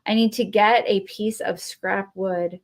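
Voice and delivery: ordinary delivery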